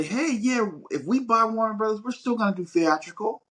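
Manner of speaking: high, cheery voice of a buyer making promises; mock corporate promise. Mocking the buyers who promise to keep theatrical, high and cheery. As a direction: chirp it up like a too-eager corporate buyer. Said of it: "some of these places say".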